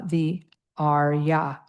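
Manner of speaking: "Arya" drawn out slowly, AHR-yah; slow Arya demo. Shown as a demonstration. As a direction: say it slowly so they hear the vowel. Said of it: "the A in father again".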